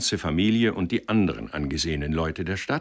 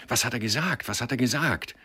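eager questioning; 6 semitones higher than usual. A narrator's reading. Crowd besieging Sender with questions.

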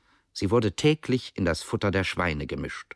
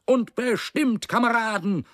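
almost pleading. A narrator's reading. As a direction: cry out to your comrades, near begging. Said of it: "rief Quiekschnauz fast flehend". (fast flehend, sehr hoch und gedehnt gerufen)

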